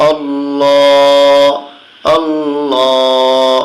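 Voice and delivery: slow, long-held chanted Allah; Quran recitation. Shown as a demonstration. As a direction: draw Allah out like tilawah. Teacher chants the word.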